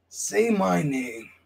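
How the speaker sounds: very slow, drawn-out catchphrase; slow menacing repeat. Acted. Wrestler demanding rivals say his name, slow and drawn out. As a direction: stretch every word like a threat. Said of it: "repeats catchphrase slowly".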